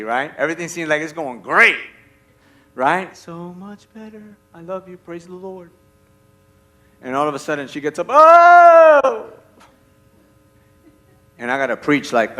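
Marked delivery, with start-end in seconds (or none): 3.2-5.7 very soft, drowsy sweet nothings; hushed bliss. Acted. Husband to wife at bedtime, hushed bliss. 8.1-9.3 long, high, loud "oh" of pain; loud mock groan. Wife waking suddenly at night, loud mock groan.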